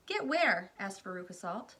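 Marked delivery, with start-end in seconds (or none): 0.1-0.7 demanding question. Acted. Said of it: "Veruca demanding".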